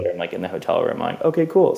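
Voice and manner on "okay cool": higher, light "okay, cool"; mock breezy. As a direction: toss it off high and breezy. Relieved in hotel room.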